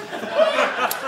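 a sudden very high burst of laughter; laughing. Real, not acted. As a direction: break into a squeaky laugh. Laugh after explaining grass.

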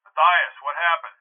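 muffled voice down a phone line; filtered phone voice. Acted. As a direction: speak as a caller heard through the handset. Police chief on the phone.

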